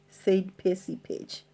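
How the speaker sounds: ordinary delivery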